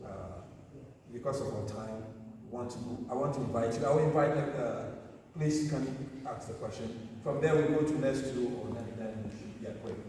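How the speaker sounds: ordinary talk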